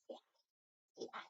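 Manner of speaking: breathy whisper urging the dog; hushed nudge to say hi; whispered coaxing. Real, not acted. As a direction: whisper it to coax the pet.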